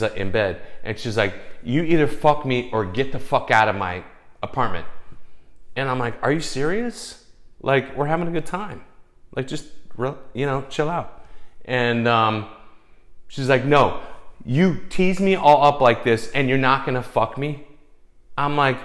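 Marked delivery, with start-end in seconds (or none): 1.6-4.9 raised voice, imitating her demand; retold angry ultimatum. Woman in bed to her reluctant date, angry ultimatum. 6.2-11.2 higher, pleading disbelief; retold incredulous protest. Date to angry woman when asked to leave, incredulous protest. 13.6-17.7 loud, higher, indignant imitation of her; retold angry accusation. Frustrated woman to the date holding back, angry accusation.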